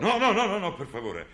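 high, loud frantic begging; panicked pleading. Acted. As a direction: beg him frantically to stop. Troll begs Cinderlad to spare the well. (supplica in preda al panico)

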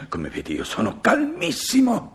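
very high, strained insistence on being calm; protesting calm hysterically. Acted. Husband insists to his wife he is calm, high and agitated. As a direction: claim calm while plainly boiling over.

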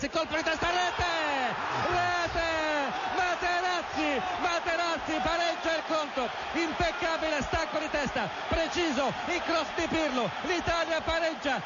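shouted, high goal call; excited goal call. Real, not acted. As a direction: yell the goal with joy. Goal commentary, equalizer scored.

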